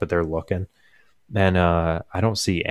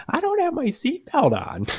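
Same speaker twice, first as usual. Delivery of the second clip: very high-pitched grandma imitation; yelling grandma. Grandma answers dad over the beeping, mock high wail. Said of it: "her yelling".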